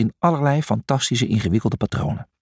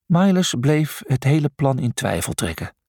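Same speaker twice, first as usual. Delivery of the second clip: ordinary delivery, nothing marked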